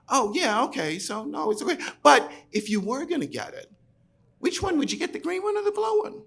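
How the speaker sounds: high, breezy saleswoman voice; Liz's sales patter. Retold or imitated. Saleswoman nudging a reluctant customer, sing-song and high. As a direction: sweetly push the customer to choose.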